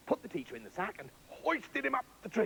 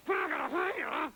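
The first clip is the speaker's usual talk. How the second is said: muffled by knives; 8 semitones higher than usual. Acted. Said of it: "You've got knives in your teeth".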